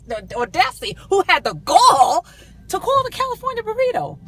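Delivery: high, loud, hammed-up outrage; retold indignant tirade. Customer scolding the restaurant manager over the burrito, hammed-up indignation. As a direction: tear into them, stretching the key words for effect.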